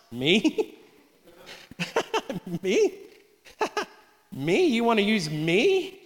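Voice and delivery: incredulous Gideon. Retold or imitated. Gideon answering the angel with amused disbelief. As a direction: play Gideon laughing off the call. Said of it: "Let's paraphrase, that's [name]'s translation".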